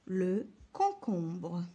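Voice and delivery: le concombre stretched out slowly; very slow dictation. Shown as a demonstration. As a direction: drag the word for pupils. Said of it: "teacher dictates word slowly". (dictée très lente)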